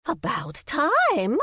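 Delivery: put-on high, drawn-out old lady cry; old lady exclaiming. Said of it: "Cora exclaimed".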